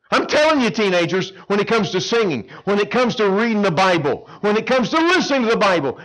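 high, loud urgent appeal; fervent sermon build; preaching. Acted. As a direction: press the point home to the teens. Preaching to teenagers.

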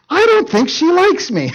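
high, hammed-up puzzled voice; mock realization. Preacher acts out what should cross Samson's mind, mock realization. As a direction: let a dim thought dawn, pitched high.

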